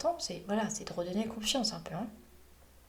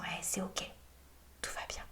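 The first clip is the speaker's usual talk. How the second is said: rassuring; 2 semitones lower, 9 dB quieter than usual. Acted. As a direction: soothe a little monster, very softly. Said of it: "on essaie de le rassurer". (apaisant, tout doucement)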